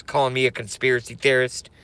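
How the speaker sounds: ordinary talk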